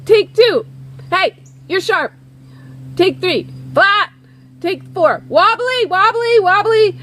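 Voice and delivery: high, punchy studio call-outs; mock producer picking faults; critical producer. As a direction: fire off curt verdicts between takes. Producer calling out takes, faults.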